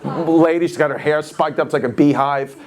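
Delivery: normal talking voice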